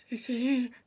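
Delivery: strained answer, imitating agony; acted pained reply. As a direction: force it out through pain. Gives age while in pain.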